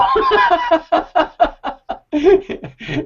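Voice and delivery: a long high laugh; high trailing laugh. Real, not acted. Laughing at his own confession.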